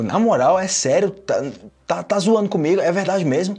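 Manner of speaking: can't-believe-it questioning, played up; acted disbelief. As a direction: press for the truth in mock astonishment. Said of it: "coisa que eu não acredito".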